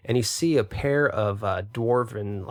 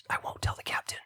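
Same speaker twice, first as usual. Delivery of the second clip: whispering; 9 dB quieter, faster than usual. Acted. Elf whispers a secret deal.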